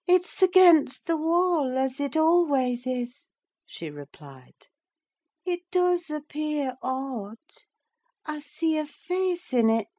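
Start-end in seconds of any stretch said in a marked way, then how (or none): 0.1-3.3 high-pitched feverish heroine; fevered young mistress, voice raised in pitch; delirious sick woman. Acted. Delirious Catherine answers her nurse. 5.4-9.9 high, eerie feverish voice; high-pitched, seeing things in a fever; puzzled invalid in a high voice; delirious sick woman. Acted. Delirious Catherine sees a face.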